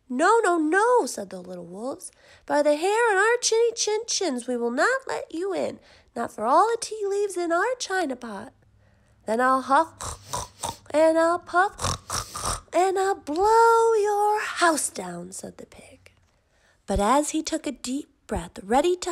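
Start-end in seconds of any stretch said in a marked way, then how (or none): 0.1-1.1 wolves cry out high and loud; high loud refusal. A narrator's reading. Little wolves refusing the pig at the door, high loud cry. 2.5-8.5 high, loud, squeaky defiance; defiant little wolves. A narrator's reading. Wolves defy the big bad pig, high and loud. 9.3-15.3 slow, loud, building menace; big bad pig threat. A narrator's reading. Pig threatens to blow the wolves' house down, slow and loud.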